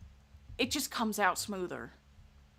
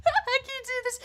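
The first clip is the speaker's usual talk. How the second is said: high, loud, breaking into laughter; can barely get it out for laughing; 8 dB louder than usual. Real, not acted. Streamer cracking up, laughing breakdown. Laughing too hard to continue.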